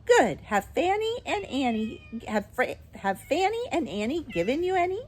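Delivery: put-on brisk old shopkeeper. A narrator's reading. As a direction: question the customers briskly. Voices Miss Corey.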